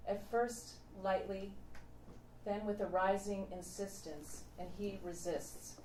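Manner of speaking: ordinary delivery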